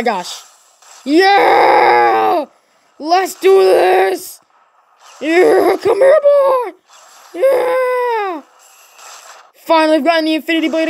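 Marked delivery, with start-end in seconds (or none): 1.1-8.4 loud, higher triumphant yells; excited shouting. Real, not acted. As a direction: whoop it up, you finally got the blade. Finally got the Infinity Blade.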